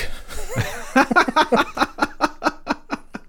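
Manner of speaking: a burst of high laughter that runs on; long high laugh. Real, not acted. Dad laughing at his daughter's line, high laugh.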